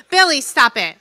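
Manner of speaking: loud, laughing "stop it" at a heckler; laughing scold. Real, not acted. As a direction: laugh while telling him off. Scolding laughing audience member.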